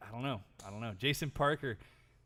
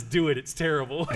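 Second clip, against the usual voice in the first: laughing; 5 semitones higher, 14 dB louder than usual. Real, not acted. Quoting friend while laughing.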